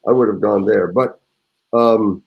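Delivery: normal talking voice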